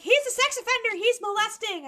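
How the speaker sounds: very high, shrieky panicked accusation; hammed-up high wail of alarm; mock hysterical mom. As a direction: imitate a mother in full freak-out. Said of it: "And she was like".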